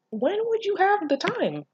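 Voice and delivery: incredulous question. Real, not acted. Quizzing the story's hero in high disbelief. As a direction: ask it like it makes no sense.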